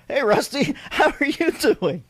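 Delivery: a hint of a gruff man voice, laughing. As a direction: put on a manly voice, laughing. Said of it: "Let me give you my man voice".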